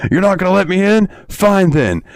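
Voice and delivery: high-pitched mock outburst; imitated angry yell. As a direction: imitate a locked-out man's yelled ultimatum. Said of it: "we hear".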